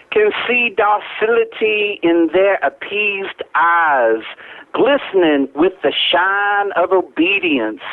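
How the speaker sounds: slow, drawn-out Southern oratory; Southern accent. Acted. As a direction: recite it like a Southern orator savoring bourbon. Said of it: "the Southern accent, my own Southern accent".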